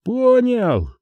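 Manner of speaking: high-pitched, reluctant reply from afar; joyless acknowledgement. A narrator's reading. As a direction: concede without any joy. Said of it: "безрадостно ответили с той стороны". (безрадостный ответ высоким голосом)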